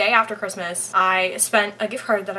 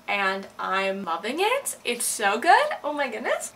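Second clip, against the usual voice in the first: ordinary delivery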